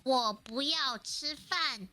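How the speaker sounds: "我不要吃饭" slowly, syllable by syllable; slow "我不要吃饭" down for a learner; slowed repeat. Shown as a demonstration. Guest repeating for the host to copy, slow "我不要吃饭". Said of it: "what, say that again?".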